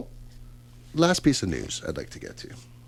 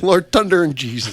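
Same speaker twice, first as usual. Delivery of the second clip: put-on Newfoundland accent; 8 semitones higher, 6 dB louder than usual. Newfoundland exclamation imitated.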